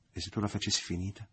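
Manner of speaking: usual speaking voice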